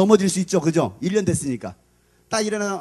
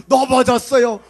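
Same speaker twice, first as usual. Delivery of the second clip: higher, louder imitated answer; mock student reply; 6 semitones higher, 7 dB louder, slower than usual. Bandaged student answering why, louder put-on voice.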